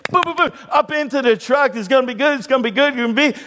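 high-pitched, giddy dog bounding into a truck; excited dog. Acted. Acts out excited dog leaping into truck.

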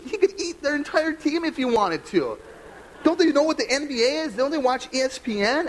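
a fan playing up his own Olympic outrage; mock outraged shout. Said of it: "I was like".

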